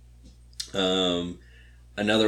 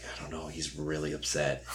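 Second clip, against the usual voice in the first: hushed, much softer low murmur; worried whisper; 5 semitones lower, 10 dB quieter than usual. Retold or imitated. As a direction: whisper it so he won't hear. Worried aside about Grant.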